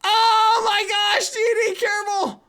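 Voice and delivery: high, loud, drawn-out cry; real panicked yell; panicked shout. Real, not acted. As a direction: shriek a warning.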